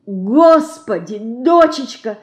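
woman moaning helplessly. Acted. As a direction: groan out the words in despair. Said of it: "простонала Лина".

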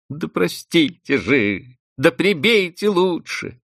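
desperate cries. A narrator's reading. Vanda desperate before the housekeeper, mild despairing cry. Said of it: "тихонько вскрикивала".

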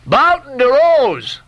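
high, slow bawling across the water; bawled hail. A narrator's reading. Stubb bawling a hail to the French ship. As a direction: hail the ship at the top of your lungs. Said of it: "he bawled".

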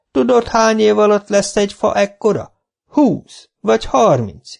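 high, loud reproachful schoolmaster; indignant old teacher. Acted. Old teacher reproaching his former pupil, high and loud. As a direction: scold him like a pupil.

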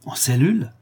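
plain reading voice

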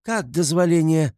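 high-pitched outraged exclamation; wailing cry. A narrator's reading. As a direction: cry out in indignation. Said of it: "завопила Катерина Ивановна". (возмущённый вопль)

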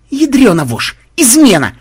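high, loud cursing yell; high-pitched yell of alarm; alarmed outcry. Acted. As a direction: cry out as if betrayed. Outcry at sudden ambush.

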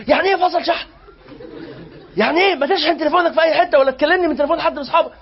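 loud, high exasperated scolding; parental scolding. Retold or imitated. Friend back late, scolded like a mother would. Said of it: "إيه الكلمة دي؟".

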